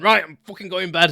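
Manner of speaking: exasperated dad. Retold or imitated. Angry dad storming off.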